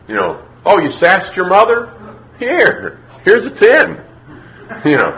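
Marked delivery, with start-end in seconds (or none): 0.6-4.0 high, loud, sing-out mock praise; absurdly generous parent, pitched way up; mock rewarding parent. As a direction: play a parent gushingly handing out a reward.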